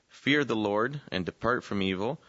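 ordinary delivery

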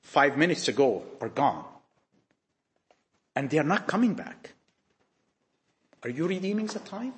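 normal talking voice

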